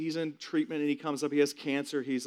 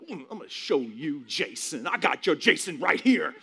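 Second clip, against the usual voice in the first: retold anger; 3 semitones higher, 4 dB louder than usual. Son retelling how mad he was at absent dad, mock anger. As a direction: work up to an acted, indignant challenge. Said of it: "I'm, like, so mad".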